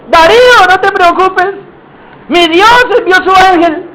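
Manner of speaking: high, projected reassurance; calling out. Acted. Daniel telling Darío not to worry, calling upward. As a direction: call up from far below, stretched and high.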